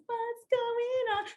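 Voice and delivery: high, slow, drawn-out cry of a retold scream; mild mock scream. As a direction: stretch it out high like a half-hearted yell. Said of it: "I was screaming at the top of my lungs".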